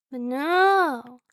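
normal talking voice

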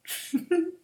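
short high-pitched giggle; high teasing giggle. Acted. Mistress to her pet, a high teasing giggle. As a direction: giggle up high, amused at him.

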